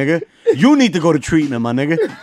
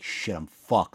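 lower, softer, sinking; dread under breath; 8 semitones lower, 10 dB quieter, much slower than usual. Retold or imitated. Pulled over at speed, muttering his dread, quiet and low. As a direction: let it sink out quietly. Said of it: "I was like".

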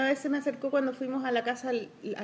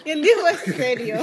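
laughing; 2 semitones higher, 7 dB louder, slower than usual. Real, not acted. Teasing about Diego, laughing.

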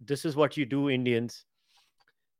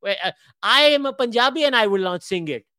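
ordinary talk, nothing marked

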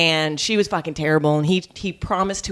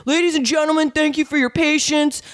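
sarcastic stage announcement, higher; mock announcer; 7 semitones higher than usual. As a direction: introduce her to the crowd with contempt. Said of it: "flings open the kitchen door and goes".

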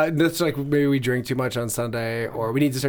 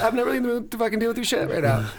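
fed-up retort; 7 semitones higher, faster than usual. Retold or imitated. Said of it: "And you're like".

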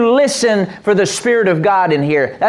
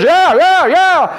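much higher, louder mock yeah; flesh gloating; 9 semitones higher, 4 dB louder, much slower than usual. Preacher voicing the flesh to the congregation, gleeful and high. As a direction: crow it like a greedy kid. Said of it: "Your flesh says".

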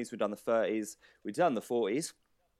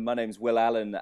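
usual speaking voice

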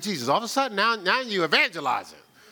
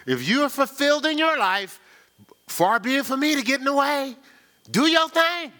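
higher, mock-gracious sarcasm; 6 semitones higher than usual. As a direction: far be it from me, said with a smirk. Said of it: "that's sarcasm".

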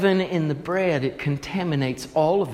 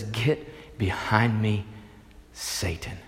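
lower, softer, slow stern rebuke sinking to a hush; sharp quiet rebuke; 7 semitones lower, 8 dB quieter, slower than usual. Retold or imitated. Jesus turning sharply on Peter: low slow quiet rebuke. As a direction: rebuke him low and cold. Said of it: "looks at him pretty sharply".

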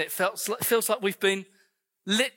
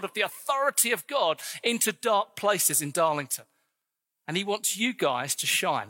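full-force preaching; 3 semitones higher than usual. Acted. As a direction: rise up and call them to shine.

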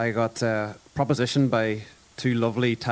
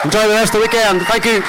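shouted sign-off; 10 semitones higher, 9 dB louder than usual. Real, not acted. Signing off to the crowd.

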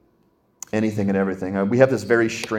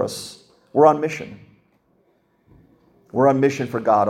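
preaching; 4 semitones higher than usual. Acted. Preaching climax.

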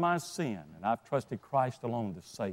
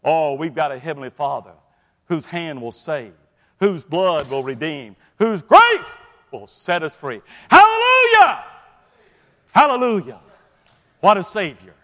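preaching at full force; 7 dB louder than usual. Acted. Preacher exhorting the congregation, rising to loud hallelujahs. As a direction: build to a joyful shouting climax.

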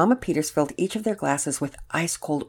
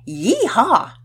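high, loud cowboy whoop; too-loud cheer; 8 semitones higher, 7 dB louder than usual. A narrator's reading. Pearson cheering for camping season, too loudly. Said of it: "too loudly".

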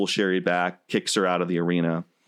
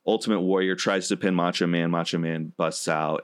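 ordinary delivery

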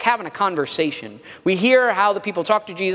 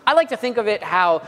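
normal talking voice

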